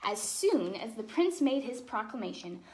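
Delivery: put-on lively, expressive read-aloud for children; storybook reading. A narrator's reading. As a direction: tell the tale like a bedtime story.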